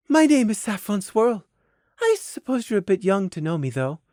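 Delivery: put-on upper-class Canterlot accent. Noble mare introducing herself to a child, upper-class Canterlot accent. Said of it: "in a perfect upper-class Canterlot accent".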